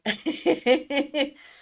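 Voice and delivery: big bright laugh; loud laugh. Real, not acted. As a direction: burst of laughter. Laughing at her own joke.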